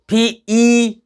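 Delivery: फी with ई held out; long E. Shown as a demonstration. Teacher sounds out feet for pupils, long E. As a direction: stretch the ई after फ. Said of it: "long sound है".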